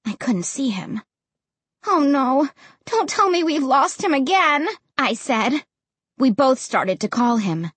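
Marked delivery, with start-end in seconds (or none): 1.8-4.7 higher-pitched groaning worry; upset, exasperated lament; dismayed. A narrator's reading. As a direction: say it with rising dismay. Dismay at losing the dog again.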